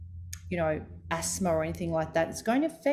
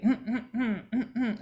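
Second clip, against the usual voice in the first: throat clearing; 4 semitones higher than usual. Shown as a demonstration. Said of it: "Avoid throat clearing".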